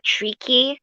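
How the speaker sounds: ordinary talk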